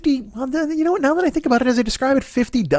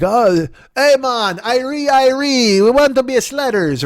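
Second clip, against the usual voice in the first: a broad, hammed-up Jamaican accent, higher and louder; 6 semitones higher, 4 dB louder, slower than usual. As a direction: play a laid-back Jamaican bobsledder with a mock accent. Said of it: "And they were like".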